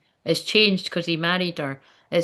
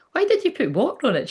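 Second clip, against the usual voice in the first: questioning mum; 9 semitones higher, faster than usual. Retold or imitated. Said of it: "I was like".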